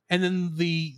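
usual speaking voice

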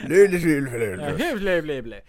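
babbled nonsense in mock Swedish; mock Swedish gibberish. Podcaster imitating the woodsman's yelling, mock Swedish gibberish. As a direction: burble like a cartoon Swede. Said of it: "but Swedish".

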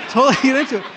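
high, loud, carried away; getting into it. Real, not acted. Preacher admitting he's into the story, loud and excited. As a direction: revel in your own performance, loudly. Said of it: "I'm totally getting into it now".

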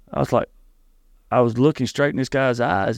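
ordinary talk, nothing marked

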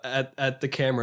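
ordinary delivery

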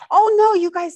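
high, loud surprised gasp of protest. Real, not acted. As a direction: caught off guard by a generous gift. Sees the gift, protests.

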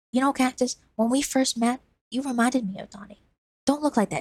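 put-on a kid pouring his heart out; earnest boy. Lizard pleading at length.

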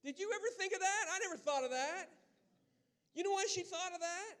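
high-pitched, excited marvelling; amazed exclamation. Real, not acted. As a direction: burst out in incredulous wonder. Preacher marvels at the sister's insight.

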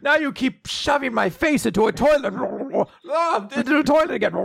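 high, whiny play-by-play of his own beating; hammed-up yelps while getting hit; mock pained self-narration.

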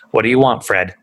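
ordinary talk, nothing marked

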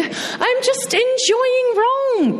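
high, slow forced brightness; brushing him off with a forced cheerful tone; fake cheer. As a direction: cover the sadness with a sing-song lie. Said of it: "I lied".

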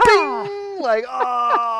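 sudden high loud yelp of pain; bing, then a loud strangled oh; mock-hurt cry from a five-year-old's punch. Daughter's punch lands.

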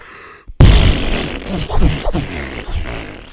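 a long, loud, sputtering mock blast from the lips; imitated fart noise. Telling friends about the loud toilet, mock fart blast. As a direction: blow out a big raspberry like the toilet sound. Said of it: "It was like".

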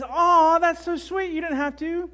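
high, drawn-out touched cooing; high-pitched grateful gush; gushing sweetheart. Acted. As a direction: act out a wife delighted by a gift. Voicing a touched wife again.

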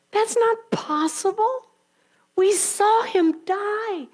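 high, slow, protesting disbelief; doubting women. Acted. Women protest to the angels, high and disbelieving. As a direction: plead that it's impossible.

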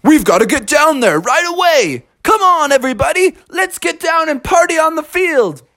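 high, eager rallying call; rally the whole family to celebrate; thrilled, high-pitched urging; excited father bear. Acted. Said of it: "And Father Bear said".

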